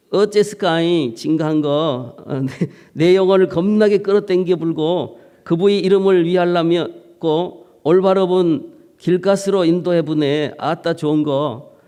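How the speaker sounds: Psalm in broad Jeolla dialect, lightly hammed; folksy Jeolla reading with an 아따 flourish. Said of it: "또 전라도 버전입니다".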